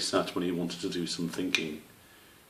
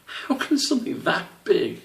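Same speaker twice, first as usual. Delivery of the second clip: laughing disbelief; 13 semitones higher, 4 dB louder than usual. A narrator's reading. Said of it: "Squish laughed".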